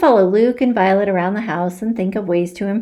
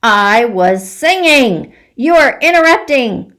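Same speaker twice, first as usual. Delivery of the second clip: loud, slow furious protest rising higher; boiling mad; 5 semitones higher, 9 dB louder than usual. Acted. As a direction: storm at them for the interruption. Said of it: "boiling mad".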